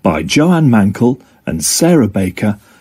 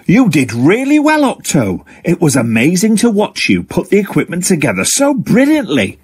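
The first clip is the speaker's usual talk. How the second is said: higher, excited congratulations; delighted praise; 7 semitones higher than usual. Acted. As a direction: burst out with joy at the result. Frankie congratulates Octo.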